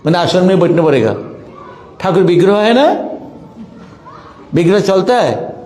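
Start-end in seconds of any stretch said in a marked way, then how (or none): none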